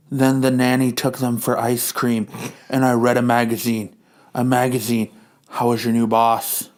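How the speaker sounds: low, mildly put-on wife; lightly voiced wife. Wife asks Hank about his boss.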